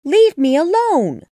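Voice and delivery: loud, high plea; upset. A narrator's reading. Alice shouting at the attacking pigeon, high. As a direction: push the bird away with your voice.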